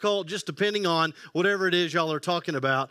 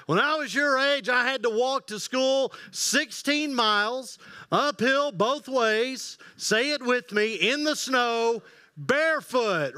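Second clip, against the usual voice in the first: put-on high, slow old-timer boast; old man voice; 5 semitones higher, slower than usual. Granddad telling the grandkids his walk-to-school tale, high and drawn out. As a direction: stretch out the uphill-both-ways brag.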